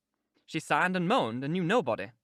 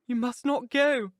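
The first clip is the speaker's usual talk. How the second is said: high, slow, insistent plea; clinging, desperate heroine; desperate woman; 7 semitones higher, 4 dB louder, slower than usual. Acted. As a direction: forbid it, drawn out and high. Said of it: "she answered, holding him as firmly".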